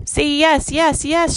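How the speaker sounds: high, loud cubs' cheering plea; excited shout. A narrator's reading. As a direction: shout eagerly like jumping children. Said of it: "shouted the cubs".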